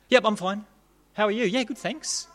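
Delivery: high-pitched polite small talk; feigned okayness. Acted. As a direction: act out brushing off a passer-by's concern. Acted small talk hiding panic.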